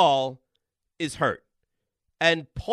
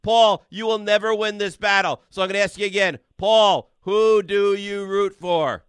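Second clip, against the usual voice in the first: loud, relentless shouting; bury him under volume; yelling; 4 semitones higher, 7 dB louder, slower than usual. Real, not acted. Host out-yelling the caller on air. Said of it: "out yell and out talk me".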